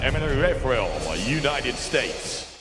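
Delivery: normal talking voice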